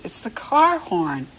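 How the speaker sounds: usual speaking voice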